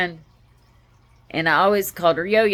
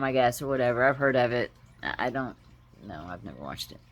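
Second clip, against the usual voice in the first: normal talking voice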